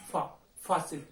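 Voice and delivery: फा alone, then Faasil with फा punched; stress on FA. Shown as a demonstration. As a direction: isolate फा, then say Faasil leaning on it. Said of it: "फा पे". (पहले फा, फिर फासिल में फा पर ज़ोर)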